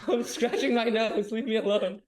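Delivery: high, laughing protest; giggly, playful defensiveness. Real, not acted. Caught on camera, laughing defensively.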